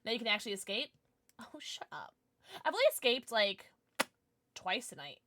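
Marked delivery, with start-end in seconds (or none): 1.4-2.1 hushed whispered aside. Real, not acted. Streamer teasing chat in a playful whisper. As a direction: breathe it out under your breath.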